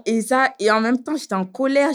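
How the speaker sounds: usual speaking voice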